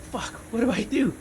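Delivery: high, panicked blurt. Retold or imitated. Panicking at the trooper barracks.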